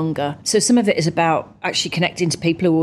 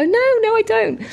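much higher, sing-song mock agreement; mock agreeable. Athlete answering a fan's makeup remark, high and sing-song. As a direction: play along sweetly with the insult. Her reply to rude remark.

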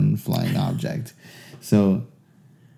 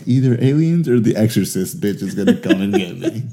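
laughing through a spooked quote; half-laughing mock dread about the exorcist; laughing mock fear; 4 dB louder than usual. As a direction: giggle out the scared thought. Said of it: "I'm like".

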